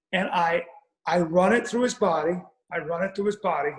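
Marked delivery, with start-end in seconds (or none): none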